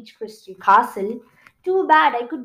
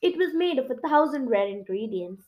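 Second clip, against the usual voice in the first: usual speaking voice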